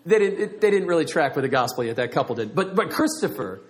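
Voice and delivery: usual speaking voice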